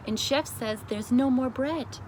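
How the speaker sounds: usual speaking voice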